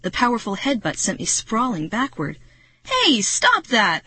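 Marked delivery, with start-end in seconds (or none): 2.8-4.0 high, loud, drawn-out shout; Marty shouting. A narrator's reading. Marty to the attacking mantis, shouting. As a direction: shout it slowly in protest. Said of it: "I heard Marty shout".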